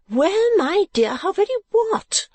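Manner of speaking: high, drawn-out astonished question; great astonishment. A narrator's reading. Mother questioning her daughter, astonished. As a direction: stretch it out, very high and amazed. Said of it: "opening her eyes in great astonishment".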